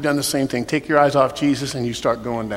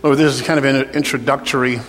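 soft, low prayer voice over music; altar call prayer; 3 semitones lower, 3 dB quieter than usual. Real, not acted. Pastor leading an altar call prayer, soft and low. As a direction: pray gently over the bowed room.

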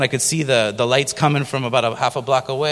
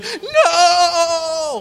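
a high, loud, half-laughing mock wail of "No!"; imitated wail; 4 dB louder than usual. Mother wailing over her choking child, high mock wail. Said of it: "She was just going".

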